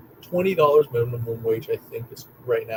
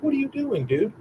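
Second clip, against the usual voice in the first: higher-pitched, incredulous question; retold bewilderment; 11 semitones higher than usual. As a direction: act out being taken aback by a friend. Reacting to cousin picking a lock.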